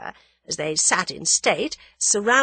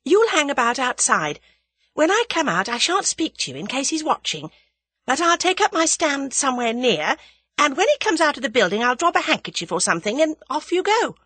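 high, loud, brisk female character; young woman character, bossy; 9 semitones higher, 6 dB louder than usual. Acted. Young woman instructing her friend on tailing, bossy. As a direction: hand out instructions with energy.

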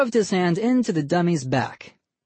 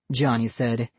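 ordinary delivery, nothing marked

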